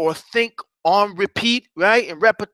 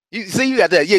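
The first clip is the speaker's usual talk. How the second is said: ordinary delivery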